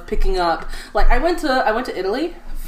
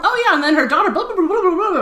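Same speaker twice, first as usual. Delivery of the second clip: high singsong mock gabbing; much higher, blathering mother impersonation; chattering mother; 8 semitones higher than usual. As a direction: imitate mum rattling on with gossip. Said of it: "she'll just be like".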